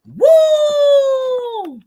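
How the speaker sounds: long, high, loud "woo"; loud whoop. Real, not acted. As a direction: let out a sustained high whoop. Whoops after laughing.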